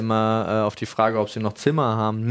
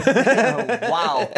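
high, stunned oh wow; shocked oh wow; 11 semitones higher than usual. Real, not acted. Hearing a friend's tasteless joke, stunned high wow. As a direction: gasp it out in disbelief. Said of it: "reacts to joke".

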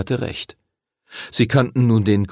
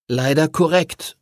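plain reading voice

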